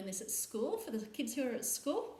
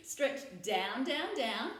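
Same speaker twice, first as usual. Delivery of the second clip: normal talking voice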